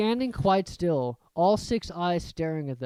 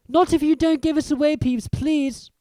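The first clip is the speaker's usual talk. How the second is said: pleading schoolboy; 11 semitones higher, 6 dB louder than usual. A narrator's reading. Begging Peeves.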